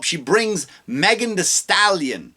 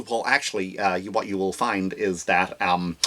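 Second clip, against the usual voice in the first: much lower, softer mock prim speaker; 9 semitones lower, 7 dB quieter than usual. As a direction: drop low and soft, mock-formal.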